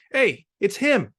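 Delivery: high, slow call across the yard; shouting at him. A narrator's reading. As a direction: point him out loudly. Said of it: "someone shouted".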